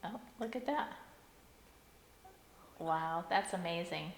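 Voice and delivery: ordinary talk, nothing marked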